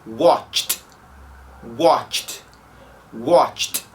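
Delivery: "watched" as one syllable ending in tcht; correct watched. Shown as a demonstration. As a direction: repeat "watched" with no ed vowel. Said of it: "Então".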